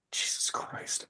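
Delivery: breathless exasperated whisper; whispered aside. Real, not acted. Host reacting to the show's optics, in an exasperated whisper. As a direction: mutter it under your breath in disbelief.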